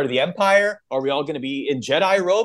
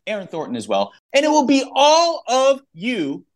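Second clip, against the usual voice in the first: big punched-up promo read with rising swoops; hyped announcer; 6 semitones higher than usual. Real, not acted. Host announcing a live podcast to listeners, hyped announcer. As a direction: hit key words hard and stretch them.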